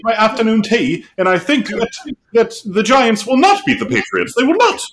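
high-pitched British cartoon pundit; Morty. Doing Mad Dog's Giants take as Morty, high British impression. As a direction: build to a squeaky, insistent British protest. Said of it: "Mad Dog as Morty".

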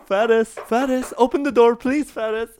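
high, pleading call through the door; calling again. Retold or imitated. Nanny pleads through the door, high and calling. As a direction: beg the toddler to open up.

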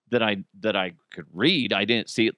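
ordinary delivery

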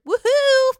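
high, loud whoop of delight; shrieking fan woohoo; excited cheer. Real, not acted. Cheering a show announcement.

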